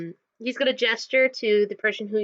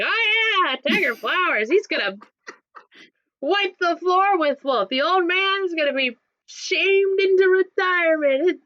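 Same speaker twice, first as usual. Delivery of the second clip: mocking braggart; 8 semitones higher than usual. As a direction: ham up a boastful fan. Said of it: "it's like".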